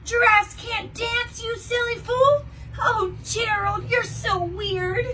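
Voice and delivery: high, loud mocking taunt; sneering taunt. Animals taunting Gerald on the dance floor, high mocking taunt. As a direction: tease him cruelly in a sing-song. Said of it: "the animals all sneered".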